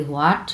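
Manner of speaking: "What" rising like English "what?"; rising questioning tune. Shown as a demonstration. Teacher comparing second tone to English, rising. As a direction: lift the end of "what" as a question. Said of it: "আমরা ইংলিশে যেভাবে what বলি".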